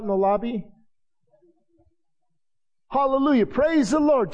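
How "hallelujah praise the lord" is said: high, excited hallelujah; mock ecstatic worshiper. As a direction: burst out like a worshiper caught up in praise.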